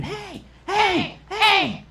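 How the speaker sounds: very high falsetto yell, held back in volume; mock girl's scream. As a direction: imitate a girl screaming from afar. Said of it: "I hear this girl screaming".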